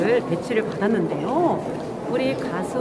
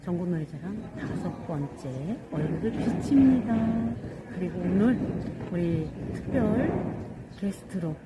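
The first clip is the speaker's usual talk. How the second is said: soft formal address; 3 semitones lower, 7 dB quieter than usual. Acted. Soft formal greeting to camera.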